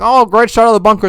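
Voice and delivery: high, fast mocking kid voice; mock praise. Host mocking the kid's praise to his grandpa, high and fast. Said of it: "And it's like".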